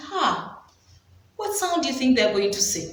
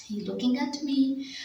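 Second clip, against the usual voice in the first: usual speaking voice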